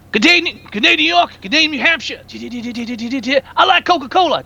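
high put-on character voice; mimicking movie character. Quoting the movie's opening line.

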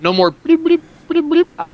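high squeaky bloop sounds; glitchy bloop noises. Retold or imitated. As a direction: mimic a dropping audio signal. Imitating audio dropouts.

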